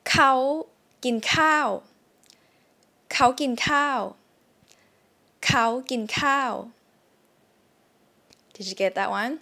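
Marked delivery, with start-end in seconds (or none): 3.1-6.5 dictation sentence. Shown as a demonstration. Teacher reading a test sentence for students. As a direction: read เขากินข้าว word by word. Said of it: "I'm gonna say a sentence".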